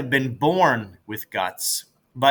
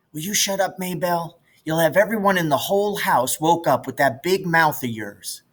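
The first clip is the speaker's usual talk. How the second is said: put-on a touch of a higher boy voice, scolding; mild brother scolding; 6 semitones higher than usual. Jess hushing his little sister, mildly scolding. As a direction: hush the sister, lightly voiced.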